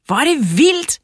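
high, loud cry of delight; excited shout. A narrator's reading. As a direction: exclaim high and loud, thrilled by the mess. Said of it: "råbte Tobias".

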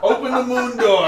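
mock Lysa. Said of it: "someone like this. It's like".

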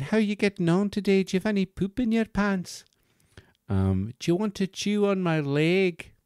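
very high Scottish bear voice; lilting Scottish nanny, pitched right up; Missus Doubtfire. Acted. Toy bear chatting to a baby, high Scottish voice. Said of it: "very soft Scottish way of talking".

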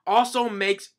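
high, very loud scolding; hammed-up outraged official; acted furious yelling. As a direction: holler at the king in exasperation. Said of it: "the guy's yelling at him".

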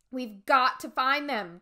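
loud, slow, scared urging; frightened cry. Acted. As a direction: push the words out in fear. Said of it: "Josh cried".

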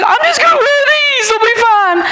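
imitating crying. Father imitating his daughter crying over ripped pants, high wail. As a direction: wail it like a panicked teen in tears. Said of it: "And she said".

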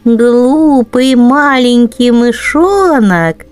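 ordinary delivery

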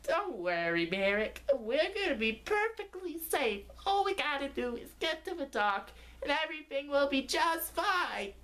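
high squeaky cartoon voice, calm; chirpy cartoon reassurance; reassuring little cone. Lenny calming his nephew Barrick, high squeaky reassurance. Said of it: "Lenny replied".

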